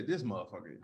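"motherfucker" slurred loosely, low and soft; down-home loose "motherfucker", barely enunciated; relaxed enunciation. Shown as a demonstration. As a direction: say "motherfucker" with lazy consonants, dropped low. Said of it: "you may not enunciate as much".

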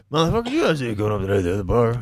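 bad mock Spanish accent. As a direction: lay on a cheesy Spanish accent. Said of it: "Kermit talks like this".

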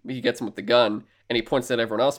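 ordinary talk, nothing marked

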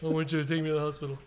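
rapid-fire lightly mimicked PSA line; shared PSA intonation. Sibling quoting the PSA both know, quick mimicked cadence. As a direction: rattle it off in the set ad cadence. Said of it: "you guys share the intonation that you know".

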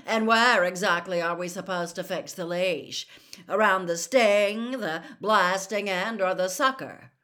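loud, jeering schoolboy bully voice; loud mocking sneer at a rival; sneering Malfoy. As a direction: taunt him like a smug rich bully. Malfoy mocking Hagrid's plan.

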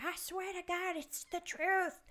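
high-pitched mock grandma, drawn out at the end; insistent grandmother. Grandmother defending her family legend, high insistent imitation. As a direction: imitate a grandmother swearing she's truthful. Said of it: "and she would, like, be like".

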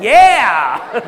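loud, high, laughing yes; excited yell. Retold or imitated. Young comic answering a friend's invitation, excited yell. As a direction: blurt it out with glee. His eager reply to invitation.

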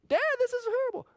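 high mock wail of a toddler; child wail. Father voicing his son's protest, a high mock wail. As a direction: play the upset little boy, pitched high. Son voiced crying.

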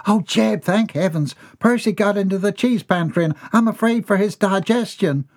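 a fussing mother relieved to see Jeb; high fretful wife's voice. A narrator's reading. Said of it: "mother frets to husband".

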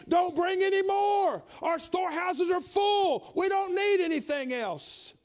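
loud, slow, high call to stop the giving; Moses calling out. Retold or imitated. Moses telling the people to stop bringing, loud and slow. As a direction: shout it out as Moses, slowly.

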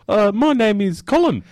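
high put-on comic caller voice; Colin. Returning caller giving a new name to the host, high put-on voice. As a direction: introduce yourself in an obvious disguise. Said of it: "sounding very similar to a previous caller".

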